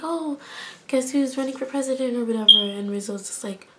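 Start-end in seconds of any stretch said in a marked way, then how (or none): 0.0-2.2 higher-pitched, softly mocked perky voice; peppy and cheery. Patty at lunch asking Rizzo, mock peppy and cheery. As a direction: imitate a chirpy cheerleader, lightly and softly. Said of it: "she's all peppy and cheery".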